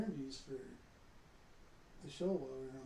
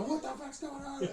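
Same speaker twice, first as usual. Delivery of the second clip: startled wife; 10 semitones higher, slower than usual. Retold or imitated. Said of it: "About sent my wife through the room".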